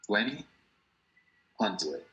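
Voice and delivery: "twenty ... hundred", with a long pause between; word-by-word demonstration. Shown as a demonstration. Teacher showing how the number is read, word by word. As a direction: leave "twenty" hanging up, then drop on "hundred". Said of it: "Se lee".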